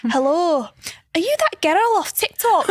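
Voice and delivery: high, sing-out Scottish accent impression; imitated Scottish woman, pitched high; thick Scottish accent. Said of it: "with a really thick Scottish accent".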